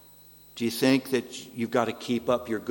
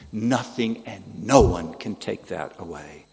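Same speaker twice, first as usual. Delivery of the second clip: ordinary talk, nothing marked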